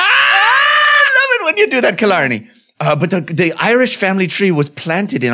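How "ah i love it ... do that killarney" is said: high squealing delight. Acted. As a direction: koala elder squealing with delight at the eucalyptus tree. Koala elder delighted by eucalyptus.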